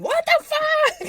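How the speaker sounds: high, slow mock scream; imitating a scream. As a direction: shriek it out in disbelief. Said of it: "I'd be screaming".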